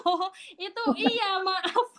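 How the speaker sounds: laughing apology. Real, not acted. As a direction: laugh through saying sorry. Laughs at own name mix-up. (minta maaf sambil tertawa)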